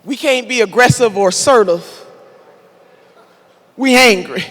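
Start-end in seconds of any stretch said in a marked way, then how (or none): 3.8-4.4 a mildly mocking echo of what critics say; mock accusation. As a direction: toss it back like their insult, lightly mocked. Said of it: "of being called angry".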